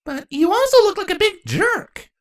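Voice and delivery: put-on high childish accusation; a kid calling names; little girl voice. A narrator's reading. Child insulting.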